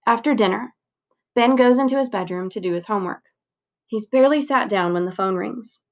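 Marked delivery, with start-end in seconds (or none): none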